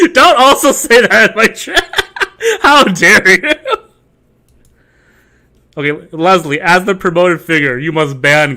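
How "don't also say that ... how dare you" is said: louder, laughing through it; laughing mock outrage. Streamer fake-offended at chat while laughing.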